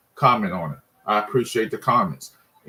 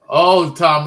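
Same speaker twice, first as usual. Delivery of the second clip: much louder, higher hail; calling out; 4 semitones higher, 8 dB louder than usual. Real, not acted. Fan answering viewer Tom in the chat, loud higher call out. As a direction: call the name out.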